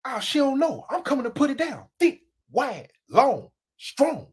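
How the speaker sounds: usual speaking voice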